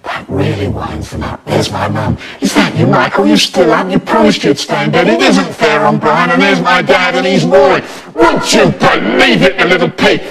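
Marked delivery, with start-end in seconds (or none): 2.4-10.2 higher, tutting mum voice; exasperated mum. A narrator's reading. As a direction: mum fussing about the boys. Mum scolding, retelling dad.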